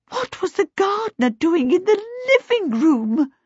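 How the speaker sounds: high-pitched, baffled demand; demanding mother. A narrator's reading. Missus Baker misunderstanding about the garden kobold, demanding. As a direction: demand an explanation as a flustered mother. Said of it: "Missus Baker demanded".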